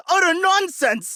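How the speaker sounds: high, outraged protest; sputtering indignant denial. Acted. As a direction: reject it in outrage. Nobleman exposed, protests.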